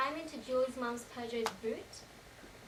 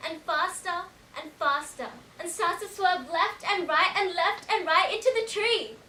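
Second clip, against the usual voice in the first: building alarm; 4 semitones higher, 9 dB louder than usual. Acted. Passenger reliving the car careering into the tree, building to a shout. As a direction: hammer each swerve louder, up to a shout. Car speeding up until the crash.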